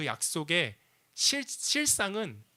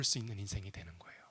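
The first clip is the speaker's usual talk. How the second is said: whisper; 9 semitones lower, 10 dB quieter, faster than usual. Acted. Hushed sermon climax.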